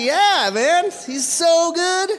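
very high, loud, drawn-out mock enthusiasm; gushing agreement. Traveller agreeing with the young fans, hammed-up enthusiasm. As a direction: gush along in an exaggerated high voice.

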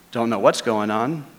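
ordinary delivery

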